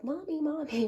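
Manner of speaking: high-pitched mimicked kid's call; imitated child calling. Mom imitates her child interrupting her steam, high mock calling. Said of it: "Aspen's like".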